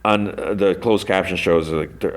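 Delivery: usual speaking voice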